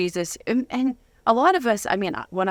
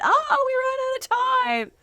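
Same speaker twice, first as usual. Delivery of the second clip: much higher, slow, sing-song fake apology; mock host voice; slower than usual. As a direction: tease like a host leaving a cliffhanger. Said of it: "and then went".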